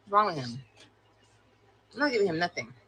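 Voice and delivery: ordinary talk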